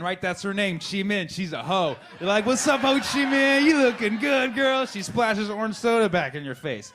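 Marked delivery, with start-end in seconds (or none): 2.4-4.8 high, slow party come-on; hammed-up flirting. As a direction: holler it high and stretched, playing the flirt.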